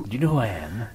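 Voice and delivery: Jason Statham. Acted. Host repeating his one line, low, softly menacing impression. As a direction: lightly imitate an action-film hard man. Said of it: "Jason Statham if you want".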